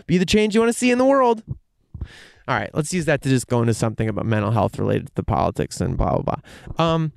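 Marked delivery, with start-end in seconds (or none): 0.1-1.4 mock slogan. Ironic slogan.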